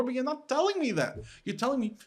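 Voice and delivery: retold frustrated reproach; exasperated complaint. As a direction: press the grievance, pitched high. Retold protest at the mentor.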